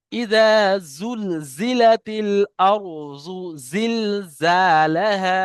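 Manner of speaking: slow, drawn-out tajweed chant; Quran recitation. Shown as a demonstration. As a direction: recite each verse slowly and melodically. Teacher models the verse again. (ধীর সুরে কুরআন তেলাওয়াত)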